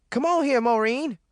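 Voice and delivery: high, urgent call; screaming. A narrator's reading. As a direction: scream for her to hurry. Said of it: "he screamed".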